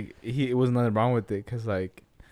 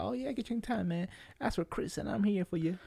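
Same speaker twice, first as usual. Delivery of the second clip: mock waiter; 8 semitones higher, 9 dB quieter than usual. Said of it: "He's like".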